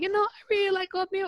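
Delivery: very high, mocking doorman; high put-on doorman voice; doorman impression. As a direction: squeak it out like a silly doorman. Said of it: "Is that how he spoke?".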